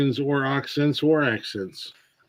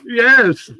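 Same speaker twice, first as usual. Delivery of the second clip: emphatic yes; 9 semitones higher, 7 dB louder than usual. Real, not acted. Insisting it was Walken.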